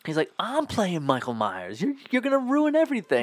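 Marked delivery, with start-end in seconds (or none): 1.8-3.2 high, fast hammed-up scolding. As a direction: rattle it off like an outraged actor. [name] scolds fake [name].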